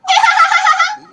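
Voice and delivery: very high, loud mock cackle; piercing chipmunk-like shriek; imitated girls' screech. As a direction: mimic squealing teenage girls. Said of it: "they literally sounded like that".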